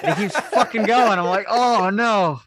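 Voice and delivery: loud, high cry of mock dismay; retold alarm. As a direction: wail it in comic disbelief, far above normal pitch. Reliving shock at the video.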